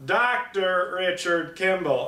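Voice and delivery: mock Tommy Lee Jones hammed up; Tommy Lee Jones impression. Reviewer mocking Tommy Lee Jones's role, hammed-up drawl. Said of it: "And he's just".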